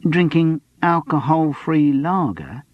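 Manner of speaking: slow, wary youth voice; tentative, slow suggestion; cautious. A narrator's reading. The spotty youth cautiously suggests alcohol-free lager, slow and wary. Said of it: "he offered cautiously".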